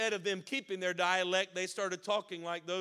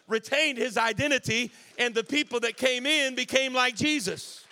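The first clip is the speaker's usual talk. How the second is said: high, driving preacher's voice growing louder; full-force preaching, building; 4 semitones higher, 6 dB louder than usual. Real, not acted. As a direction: preach with mounting force, hammering each repeated phrase.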